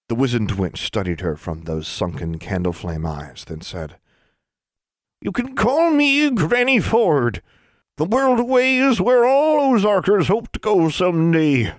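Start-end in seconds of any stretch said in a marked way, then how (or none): 5.2-11.7 high, slow ancient crone; Granny Ford. A narrator's reading. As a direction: draw it out like a wise old witch.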